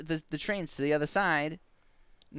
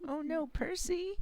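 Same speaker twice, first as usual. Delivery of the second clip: put-on very high, slow little-kid voice; high-pitched mock concern; child's dismay; 5 dB quieter, slower than usual. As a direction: imitate a small child's drawn-out worry. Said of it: "She does it so good".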